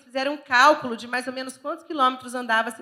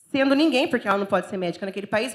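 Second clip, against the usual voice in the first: usual speaking voice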